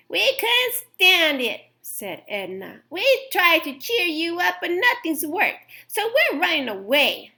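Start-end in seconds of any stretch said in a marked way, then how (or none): 0.1-1.7 higher, louder, slow animal voice; Edna voice. A narrator's reading. Edna explains running away. 2.9-7.3 higher, louder animal voice; Edna voice. A narrator's reading. Edna explains running away.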